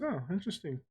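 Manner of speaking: normal talking voice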